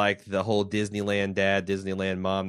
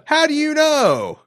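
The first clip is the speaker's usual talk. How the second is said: high, loud, drawn-out mock outrage; mock indignant challenge; 10 dB louder, slower than usual. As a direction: shout it as an exaggerated challenge to a correction. Mock outrage at being corrected.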